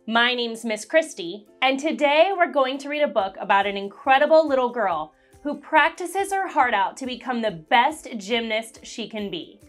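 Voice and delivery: ordinary delivery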